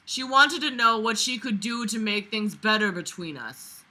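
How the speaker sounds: usual speaking voice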